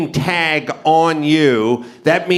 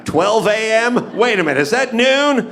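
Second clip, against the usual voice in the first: higher-pitched, baffled questioning; puzzled reading; 7 semitones higher than usual. Acted. Driver trying to decode a parking sign, baffled. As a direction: puzzle it out aloud, pitch climbing.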